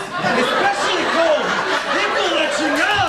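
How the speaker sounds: high-pitched exasperated father impression; ranting dad. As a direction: work yourself up as an indignant dad. Dad ranting about coffee prices.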